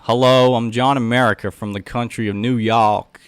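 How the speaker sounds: loud, hammed-up American accent. Shown as a demonstration. Streamer playing John America for viewers, loud American accent. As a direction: put on a big, cheesy American accent. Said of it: "try my best to keep the American accent".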